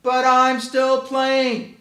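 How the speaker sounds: high, loud, slow drawn-out whine; whiny cat kid. Acted. Cat kid called to dinner, slow high whining protest. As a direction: stretch the whine like a stalling child. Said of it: "When cats and dogs are called".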